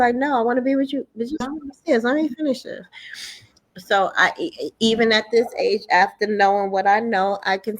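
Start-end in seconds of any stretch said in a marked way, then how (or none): 0.2-2.8 high-pitched mimicked back-and-forth; retold pleading. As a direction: act out a whiny standoff, voice up high. Voices pleading child and exasperated mother.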